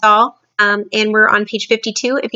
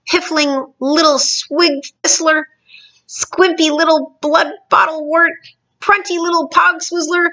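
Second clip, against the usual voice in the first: high, deliberate name-calling; bullying giant's raised taunts; shouted insults; 8 semitones higher than usual. A narrator's reading. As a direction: pile up the insults with scorn. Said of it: "he shouted".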